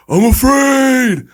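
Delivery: high, loud Rocky scream. Retold or imitated. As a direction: yell it like Rocky on the beach.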